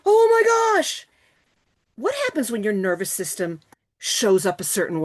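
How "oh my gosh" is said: high, loud, slow mock gasp of fear; mock fright. Shown as a demonstration. Acting out a scared nervous system, in mock fright. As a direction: play it hammed-up scared.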